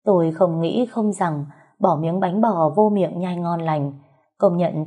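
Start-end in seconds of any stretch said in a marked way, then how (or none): none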